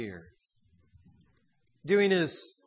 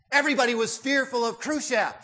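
ordinary talk

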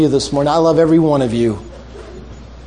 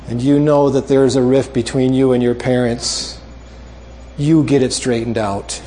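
ordinary talk, nothing marked

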